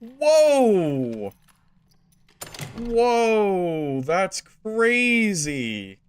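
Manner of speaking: high, drawn-out astonished whoa; real stunned amazement, slowly; amazed whoa. Real, not acted. Reacting to a shocking play.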